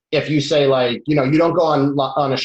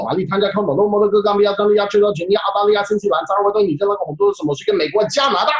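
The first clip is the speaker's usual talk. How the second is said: rapid-fire guankou list; 3 semitones higher, faster than usual. Shown as a demonstration. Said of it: "rattle off a lot of things to show the shuo".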